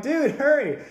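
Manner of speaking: high, loud hurry-up; acted impatient shout; urgent call. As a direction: call out to speed him up. Urging technician again.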